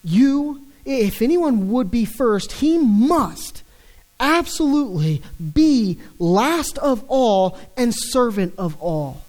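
"absolutely" leaned on, slowed and stretched; emphatic. Acted. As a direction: press every word of "must absolutely be last". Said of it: "as the most emphatic Jesus could have been".

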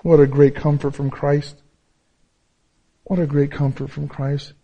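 ordinary delivery

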